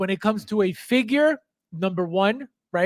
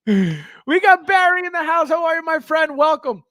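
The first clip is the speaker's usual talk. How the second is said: hyped greeting; 8 semitones higher, 5 dB louder than usual. Real, not acted. Hyped welcome to a chat viewer.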